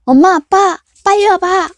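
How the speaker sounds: put-on high, loud child's yell; boy yelling. A narrator's reading. Son yelling for his parents from his room. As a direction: call out for mom and dad in a panic. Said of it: "돌연 고함을 질렀다".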